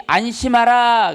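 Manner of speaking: "안심하라" said slow, high and firm; slow emphatic reading. Shown as a demonstration. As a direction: stretch out "안심하라" to stress it. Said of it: "자, 여기". ("안심하라"를 천천히 힘주어)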